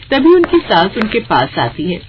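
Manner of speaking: usual speaking voice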